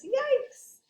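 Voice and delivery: high startled yelp; startled yikes. Acted. Sam hears Carrie's guitar, high startled yikes. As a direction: yelp in alarm. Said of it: "and he says".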